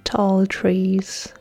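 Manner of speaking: ordinary talk, nothing marked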